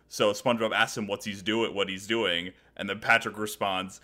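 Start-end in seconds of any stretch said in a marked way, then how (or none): none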